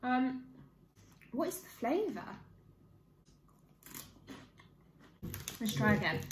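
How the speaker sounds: talking between bites; biting into a snack; mouth full, chewing. Real, not acted. Seen 2: Bites curls at lips, chews between lines; chewing visible until 6.3.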